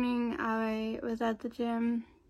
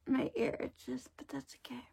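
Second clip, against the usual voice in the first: whispering; 7 dB quieter than usual. Real, not acted. Quiet aside about itchy ear.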